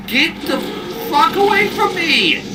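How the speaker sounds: panicking gamer. Real, not acted. Attacked by monster.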